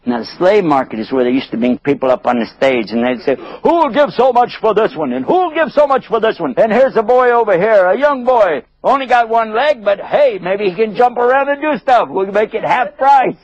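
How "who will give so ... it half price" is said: pitched-up auction patter; auctioneer. Acted. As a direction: hawk the goods to the crowd. Slave auctioneer calls bids.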